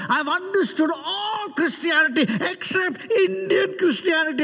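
high-pitched, hammed-up proclamation; imitated declaration. Preacher walking among students in second session, high-pitched declaration.

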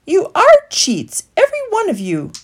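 high, loud indignant retort; sharp retort. A narrator's reading. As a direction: fire it straight back. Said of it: "retorted Woodley".

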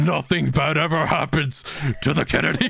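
playful put-on voice; creepy image voice. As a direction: voice the creepy picture. Voicing the image talking.

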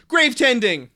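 loud, triumphant exclamation; excited. Real, not acted. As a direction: shout it out like a discovery. Decides on the name.

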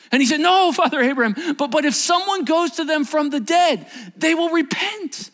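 very high, loud protest; desperate shouted plea. Acted. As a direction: cry out, slowing on the last words. Rich man protesting to Abraham.

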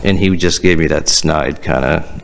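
ordinary delivery

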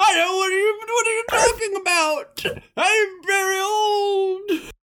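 put-on high, quavering old man voice; creaky elderly man, straining upward. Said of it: "do an old man voice".

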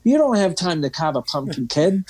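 exaggerated Boston salesman voice; Boston accent. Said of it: "talking with a Boston accent".

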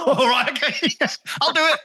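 laughing through a joking reply. Real, not acted. Host jokes he'd take the job for double pay, laughing.

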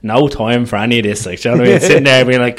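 normal talking voice

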